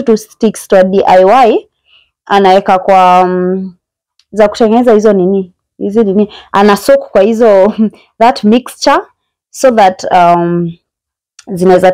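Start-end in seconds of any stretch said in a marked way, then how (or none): none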